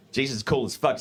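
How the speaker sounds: normal talking voice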